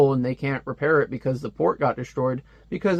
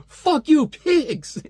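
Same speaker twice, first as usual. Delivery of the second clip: high, loud mock fury at the TV; furious outburst; 13 semitones higher, 3 dB louder, much slower than usual. Stepfather cursing at the TV, high mock fury. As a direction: yell it at the screen in mock rage. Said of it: "He's like".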